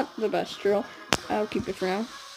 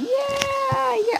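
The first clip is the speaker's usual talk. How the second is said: high-pitched drawn-out cheer; long, high "yeah" of celebration. Pretend. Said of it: "I'm gonna cheer".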